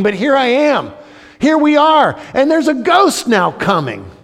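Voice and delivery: high, loud preaching as the panicking disciples; impassioned preaching. Acted. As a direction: preach the panic at full force.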